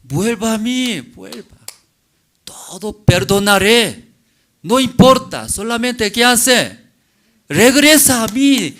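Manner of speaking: loud, high, earnest pleading; forgiving husband's plea. Acted. Husband begging his unfaithful wife to return, loud plea. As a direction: call her back with all your heart.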